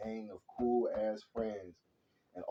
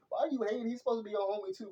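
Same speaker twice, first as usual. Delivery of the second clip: much higher, exasperated questioning; exasperated challenge; 11 semitones higher, faster than usual. Retold or imitated. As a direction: call out a hater in exasperation. Challenging a jealous hater in retold argument.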